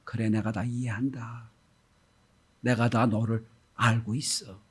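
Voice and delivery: soft, slow consolation; gentle understanding. Acted. God answers the confessing believer, soft and gentle. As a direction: quietly tell them you understand. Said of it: "하나님 이렇게 말씀하실 거예요".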